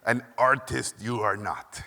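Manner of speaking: put-on slow Hungarian accent, solemn pronouncement; thick Hungarian accent, drawn-out wise-master cadence; Yoda. Hungarian mentor passing judgement on a student, slow thick accent. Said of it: "He talked like Yoda".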